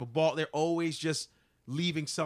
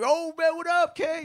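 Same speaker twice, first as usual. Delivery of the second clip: high, loud hail; excited fan greeting; 13 semitones higher, 6 dB louder than usual. Acted. Fan who has seen all the movies, excited greeting. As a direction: greet a star you recognize from the movies. Voices fans greeting him.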